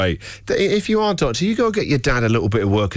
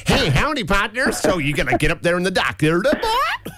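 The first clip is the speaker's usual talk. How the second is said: put-on a jokey, overdone Western accent; mock American cowboy; 5 semitones higher than usual. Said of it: "I'm tuning it in".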